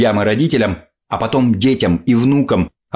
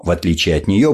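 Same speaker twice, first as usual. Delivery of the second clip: usual speaking voice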